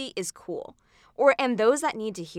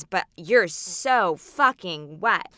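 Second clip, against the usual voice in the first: slow leering line; 5 dB louder, much slower than usual. Acted. Performer voicing a leering boy.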